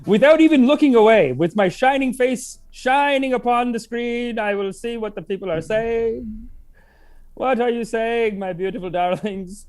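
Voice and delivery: slow, drawn-out seer voice; mock mystic. Host in karmic glasses reading the chat as a mock mystic. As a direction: intone it like a hammed-up fortune teller.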